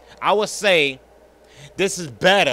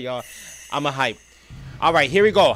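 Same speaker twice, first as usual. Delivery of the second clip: normal talking voice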